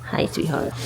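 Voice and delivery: soft whispered greeting; whispering to a dog. Real, not acted. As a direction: whisper tenderly to him.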